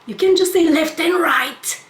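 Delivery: venting, almost yelling in disbelief; exasperated rant. Real, not acted. Ranting at the confusing sign.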